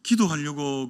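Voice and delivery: ordinary delivery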